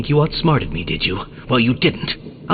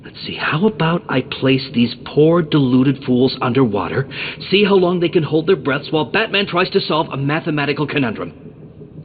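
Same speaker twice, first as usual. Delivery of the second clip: ordinary talk, nothing marked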